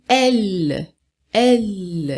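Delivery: "L" as French elle, ending on a held l; strong final L. Shown as a demonstration. Teacher showing the French word elle, strong final L. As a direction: sound out the final consonant of "L" slowly. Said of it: "É um L que é mais forte".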